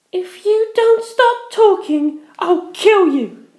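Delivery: high, slow and loud threat; slow menacing threat. Acted. Penelope threatens her ex, high, slow and loud. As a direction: threaten to kill, drawn out.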